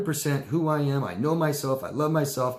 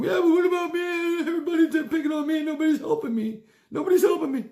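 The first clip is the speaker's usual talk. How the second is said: very high, louder whimpering self-pity; whiny poor victim; 5 dB louder than usual. Acted. Poor victim whining that nobody helps, very high. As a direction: wail like the wronged one. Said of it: "the poor victim saying".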